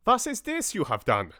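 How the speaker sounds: put-on high, loud sputter in a corny German accent; furious German accent, shouted high; spluttering German accent. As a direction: splutter it out as an outraged German host. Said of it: "he spluttered".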